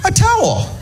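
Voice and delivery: very high sarcastic gush; mock delight. Comic mocks the towel idea, high sarcastic squeal. Mocking the suggestion.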